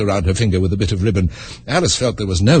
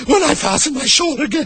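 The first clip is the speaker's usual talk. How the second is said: a touch of a groan, high; mildly groaned, high. Acted. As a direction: moan over the shawl. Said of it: "the poor Queen groaned out".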